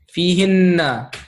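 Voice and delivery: fihinna spelled out slowly, fee-hin-na; slow model word. Shown as a demonstration. Teacher slowly models fihinna for the class. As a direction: draw out fihinna for learners. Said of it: "teacher models plural form".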